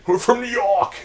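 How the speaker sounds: loud, high mock New Yorker; a hammed-up Brooklyn voice; bad New York accent. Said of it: "that is not a New York accent".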